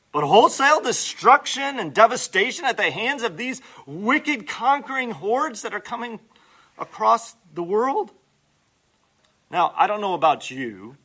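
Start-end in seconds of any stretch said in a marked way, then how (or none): 0.1-8.1 raised and indignant; indignant protest. Acted. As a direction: press the complaint, slowing at the end. Habakkuk protests God's plan.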